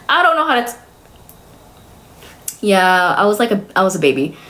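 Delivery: ordinary delivery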